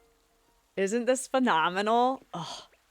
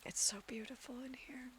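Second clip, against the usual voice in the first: whispering; 6 semitones higher, 15 dB quieter than usual. Real, not acted. Awed in quiet room.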